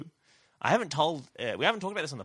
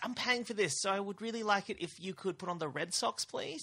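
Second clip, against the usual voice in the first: entitled customer; 8 semitones higher, 5 dB quieter than usual. Retold or imitated. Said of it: "they're like, Oh".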